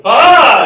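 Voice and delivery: loud high heroic "Ah!"; superhero yell. Shown as a demonstration. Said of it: "making superhero noises".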